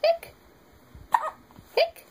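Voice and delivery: high squeaky hiccup sound; imitated hiccup. Parent copying the baby's hiccups, squeaky. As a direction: mimic a baby's tiny hiccup.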